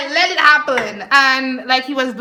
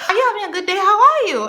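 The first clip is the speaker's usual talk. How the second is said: high, chirpy mock greeting; sing-song "how are you" impression; 8 semitones higher, faster than usual. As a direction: imitate a bubbly hello, pitched up. Acting out her friendly greeting.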